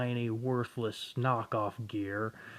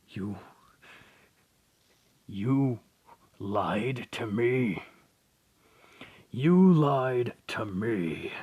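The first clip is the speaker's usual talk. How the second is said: slow bitter accusation; 5 dB louder, much slower than usual. Acted. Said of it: "he gets really irritated".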